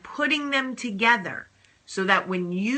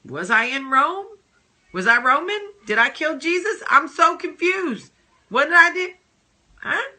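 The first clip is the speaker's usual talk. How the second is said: mock-bewildered, climbing higher and louder; hammed-up confusion, higher with each question; confused victim; 8 semitones higher, 4 dB louder than usual. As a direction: play the frantic self-doubting victim, rising into panic. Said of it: "you start to wonder, like".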